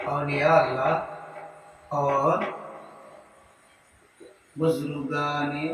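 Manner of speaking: slow, soft word-by-word dictation with pauses. Shown as a demonstration. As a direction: dictate each phrase for students to write down. Dictates second answer. (धीमे-धीमे, रुक-रुक कर लिखवाना)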